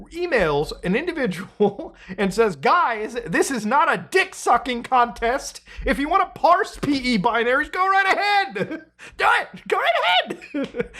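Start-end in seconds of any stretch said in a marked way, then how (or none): 2.6-10.9 higher and laughing, hammed-up rant; hammed-up angry rant voice, pitch climbing high; mock angry Linus. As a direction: read the email like a cartoon tyrant, cracking up. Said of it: "I wanna read you a few emails from Linus Torvalds".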